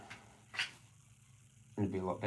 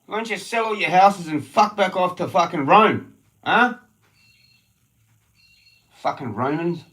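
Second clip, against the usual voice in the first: high, loud raging tirade; angry shouting rant; 10 semitones higher, 14 dB louder than usual. Real, not acted. Ranting at the locals about selling houses, angry shout. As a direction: keep shouting it out.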